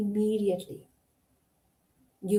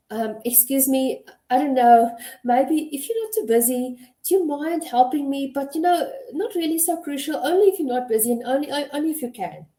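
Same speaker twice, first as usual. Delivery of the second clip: higher-pitched, rambling, apologetic request; pathetic, hesitant plea; 4 semitones higher, faster than usual. Shown as a demonstration. Employee meekly asking a coworker for help, pathetic and unsure. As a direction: timidly backpedal while asking for help. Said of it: "How does that sound? That sounds pathetic".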